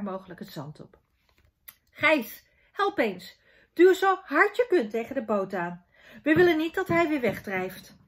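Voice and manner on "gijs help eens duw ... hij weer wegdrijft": loud, raised-pitch call for help; urgent acted dad orders; calling out instructions. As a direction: call your son over to push hard. Father calls son to help push.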